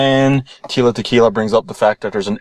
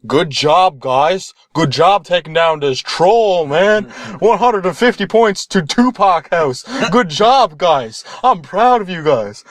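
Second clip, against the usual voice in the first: higher-pitched congratulating cheer; hearty praise; 7 semitones higher, slower than usual. Acted. Teachers congratulating students on the troll, hearty praise. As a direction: hand out praise with playful gusto.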